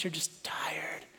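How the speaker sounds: soft, slow whisper to weary moms. Real, not acted. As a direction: breathe it out gently, just above a whisper.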